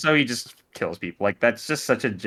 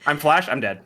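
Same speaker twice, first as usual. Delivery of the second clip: hyped gamer callouts; frantic ranked-match comms; Valorant comms voice; 6 semitones higher, 6 dB louder than usual. Shown as a demonstration. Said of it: "It's my best Valorant voice?".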